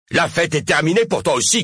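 high, rapid comeback; angry retort. A narrator's reading. Mayor telling Cosima her party is over, angry. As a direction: throw it back at her fast.

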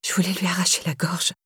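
soft, whispered admission; whispered confession. A narrator's reading. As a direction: let it slip out under your breath. Confession that slips out under questioning.

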